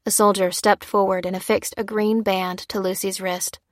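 plain reading voice

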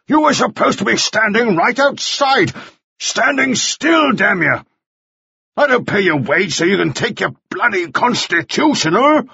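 high-pitched irate scolding; angry shouting. A narrator's reading. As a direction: berate the boy like a furious employer. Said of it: "Mannering shouted".

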